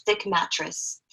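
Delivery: ordinary delivery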